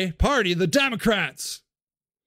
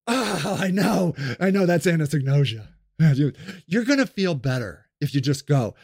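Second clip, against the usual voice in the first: mock soothing; 6 semitones lower than usual. Soothing patronizing official.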